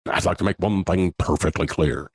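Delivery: a light Nixon voice for friends; lightly voiced Nixon quote; Nixon impression. Telling podcast hosts about boyhood impressions, light Nixon voice. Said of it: "Reagan and Nixon".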